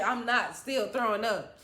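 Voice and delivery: ordinary talk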